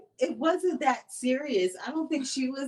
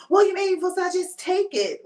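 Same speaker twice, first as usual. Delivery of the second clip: higher, louder whiny comeback; mock petulant retort; 7 semitones higher, 6 dB louder than usual. Retelling her answer to his apology, mock petulant. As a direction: imitate her refusing to accept an apology. Acting her side of the quarrel.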